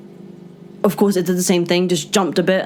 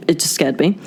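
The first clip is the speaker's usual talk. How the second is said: ordinary delivery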